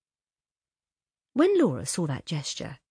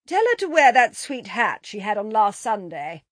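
higher, louder, floating call; mother's raised, carrying voice; calling downstairs; 4 semitones higher, 6 dB louder than usual. A narrator's reading. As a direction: send the words down the stairs. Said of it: "Missus Sheridan's voice floated down the stairs".

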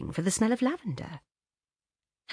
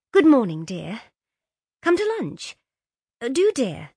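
bright phone voice; 6 semitones higher, 6 dB louder than usual. Acted. Kitty on the telephone.

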